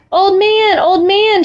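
high-pitched, lightly acted child's call; shouting. A narrator's reading. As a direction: call out urgently to the old man. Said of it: "shouted Chang".

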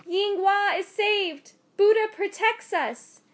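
high, loud joyful cry; overjoyed. A narrator's reading. Mother learning her daughter is saved, high joyful cry. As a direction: burst with relief. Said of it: "broke into a big smile".

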